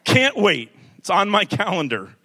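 loud, high gleeful cackling jab; gleeful mock congregant. Imitating a gleeful churchgoer, loud and high. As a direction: crow it like a delighted teen who can't wait. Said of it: "She, she's like".

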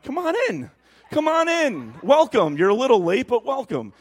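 overdone cheery welcome; mock welcome. Greeting the late visitor, a hammed-up high welcome.

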